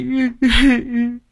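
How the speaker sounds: high wailing sob. A narrator's reading. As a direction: let out a broken sob. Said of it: "vừa khóc vừa mếu máo". (tiếng nức nở)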